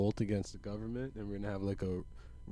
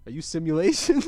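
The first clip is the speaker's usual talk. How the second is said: loud, high accusing question; loud suspicious question; 6 semitones higher, 10 dB louder than usual. Acted. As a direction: demand it loudly.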